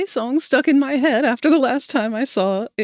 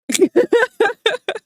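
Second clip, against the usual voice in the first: high laugh; 12 semitones higher, 4 dB louder than usual. Real, not acted. Remembering the Italian accent, a high laugh.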